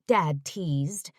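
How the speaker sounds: ordinary delivery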